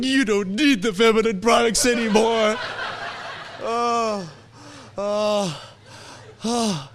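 high, hammed-up weepy voice; mock crying. Shop lady to expectant father, mock sobbing. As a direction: imitate sobbing through the words, trailing into little ohs. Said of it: "she's crying and I'm crying".

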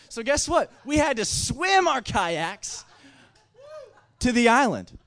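loud, high, slow building reveal; excited punchline. Real, not acted. Telling the audience the comic twist, loud high slow reveal. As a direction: land the absurd twist.

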